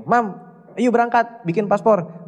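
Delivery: high, eager coaxing to get mom moving; pleading with mom. Retold or imitated. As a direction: urge her on, pitch lifted with excitement. Begging mother to make passport. (membujuk mama dengan nada tinggi dan bersemangat)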